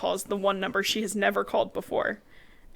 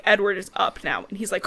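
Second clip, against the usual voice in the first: usual speaking voice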